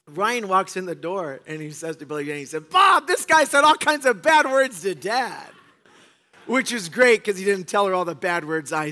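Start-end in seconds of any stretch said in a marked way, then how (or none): 2.7-5.5 put-on high, loud, slow childish telling-on; tattling kid. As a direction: play the son telling on his dad. Son tattling on dad.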